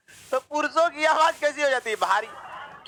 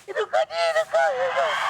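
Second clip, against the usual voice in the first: put-on much higher, sweet girlish voice; girl's voice; slower than usual. Girl calling to someone right beside her, in a high girlish voice. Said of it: "इतने बगले में कहते".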